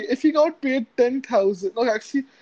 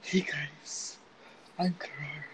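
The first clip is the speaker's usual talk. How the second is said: soft, low ASMR intro voice; hushed mock ASMR welcome; 6 semitones lower, 13 dB quieter than usual. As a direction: murmur close to the mic like an ASMR host. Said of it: "Lonely Nights with Karar and Nahil A S M R edition".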